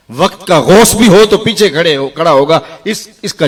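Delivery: loud, high sermon cry; fiery preaching. Acted. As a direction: thunder it out to the crowd. Preaching at full force.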